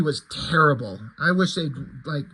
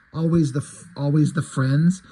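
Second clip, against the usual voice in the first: usual speaking voice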